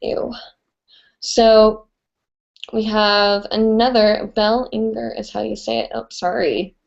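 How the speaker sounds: usual speaking voice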